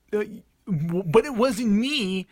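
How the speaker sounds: put-on high, drawn-out whiny six-year-old denial; little kid. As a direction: stammer into a mock-innocent child's protest. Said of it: "imagine me as, like, a little six".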